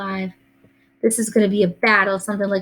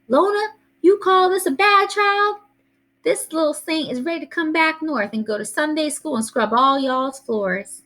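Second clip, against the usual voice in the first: shouting grandma; 8 semitones higher than usual. A narrator's reading. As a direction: holler down the phone in a high old-lady voice. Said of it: "while she shouted".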